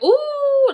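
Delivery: high loud labor moan; pained moan. Retold or imitated. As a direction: let out a sharp pained ooh. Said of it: "just being loud, but not that loud".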